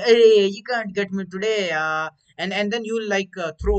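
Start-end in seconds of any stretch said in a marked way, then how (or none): 0.0-1.7 slow, loud hero taunt; cocky hero. Retold or imitated. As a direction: gloat like a movie hero. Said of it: "It's like".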